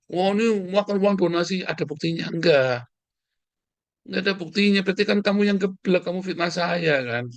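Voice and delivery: high-pitched scornful rebuttal; worked-up, affronted retort; indignant. Real, not acted. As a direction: throw the accusation back at them. Rebuts donation slander, insults accuser.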